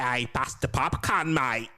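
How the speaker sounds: broad Aussie bogan twang; full-on bogan. Acted. Teasing a co-host about her accent, full-on bogan. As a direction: lay on a thick bogan Australian accent. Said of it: "that's when my full-on bogan".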